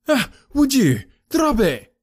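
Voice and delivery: high, urgent cry of warning; sharp, high-pitched order; sudden alarmed command. Acted. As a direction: shout to stop someone reaching for a weapon. Inspector stops man drawing a revolver.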